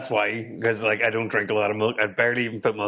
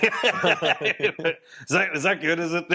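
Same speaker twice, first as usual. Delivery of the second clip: laughing; 7 semitones higher, much slower than usual. Retold or imitated. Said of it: "And, like".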